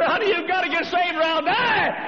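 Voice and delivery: high shouted desperate plea; high wailing shout; crying wife's plea. Acted. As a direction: cry out like a wife on the floor. Crying wife pleading, shout.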